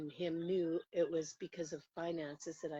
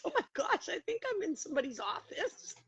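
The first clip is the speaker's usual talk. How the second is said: startled realization; 10 semitones higher than usual. Retold or imitated. Realizing she walked into office.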